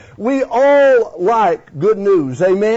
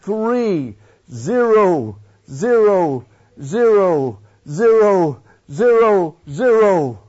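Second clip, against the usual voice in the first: drawn-out, savoring each zero; slow counting in awe; much slower than usual. Acted. A dreaming homeowner reads the prize check, slow and awed. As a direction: count the zeros slowly, amazed.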